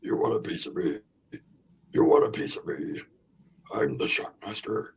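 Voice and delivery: soft, breathy gruff challenge; Ole Anderson voice. Retold or imitated. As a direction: growl it low like a tough-guy taunt. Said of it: "The John Tenta voiced by Ole Anderson".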